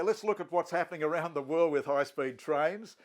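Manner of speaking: ordinary delivery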